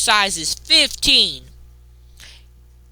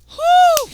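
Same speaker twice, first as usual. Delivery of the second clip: exhale; 7 dB louder than usual. Real, not acted. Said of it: "Sorry, I'm getting heated".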